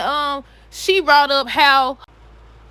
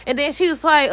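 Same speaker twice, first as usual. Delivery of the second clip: ordinary delivery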